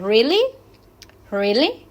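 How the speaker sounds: REALLY swooping up, loud; rising intonation. Shown as a demonstration. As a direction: ask REALLY with the pitch climbing to the end. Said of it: "Le damos entonación ascendente".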